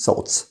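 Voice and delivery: "thoughts" said as "sots", TH turned into S and GH silent. Shown as a demonstration. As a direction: start "thoughts" with an S and skip the GH. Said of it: "ال تي اتش بننطقها س". ("thoughts" بنطق ال TH س وال GH ساكتة)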